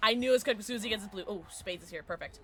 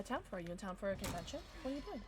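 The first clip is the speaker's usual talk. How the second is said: usual speaking voice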